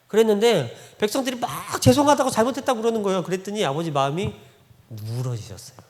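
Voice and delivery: ordinary talk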